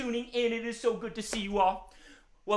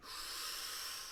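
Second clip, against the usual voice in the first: long, soft audible breath; deep breath; 14 dB quieter than usual. Pretend. Dog showing children how to calm, deep breath. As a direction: breathe out slowly to settle down. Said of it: "took some big deep breaths".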